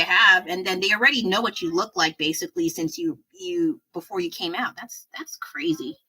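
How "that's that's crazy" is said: soft, slow unvoiced murmur; whispered aside. Real, not acted. To herself about baby photos online, whispered. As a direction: breathe it out to yourself.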